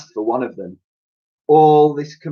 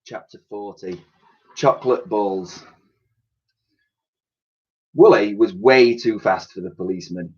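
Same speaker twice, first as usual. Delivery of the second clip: plain reading voice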